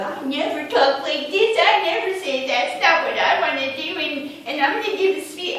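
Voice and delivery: high, loud mocking imitation of whining; mocking whiner. Friend mocking her by repeating back what she said, high whiny mockery. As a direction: mimic someone's complaints in a high, put-on voice. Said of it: "I call it mocking".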